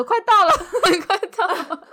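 high, loud, fast, laughing mock phone. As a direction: fake it, cracking up. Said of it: "这边说".

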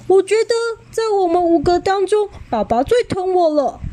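put-on high, quick smug child voice; little Xiaolu bragging to the others, smug; smug boast. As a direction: brag like the favourite kid. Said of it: "一脸得意地说".

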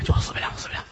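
whispered, backing down; sheepish whisper. Acted. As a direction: caught out, concede it quietly. Caught lying, backing down.

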